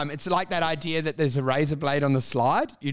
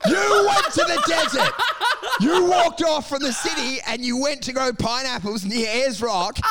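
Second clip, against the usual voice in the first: laughing rant, yelling at the foolish farmer; mock furious yell; 6 semitones higher, 4 dB louder than usual. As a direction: shout it much higher and louder, exasperated. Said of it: "I'm like".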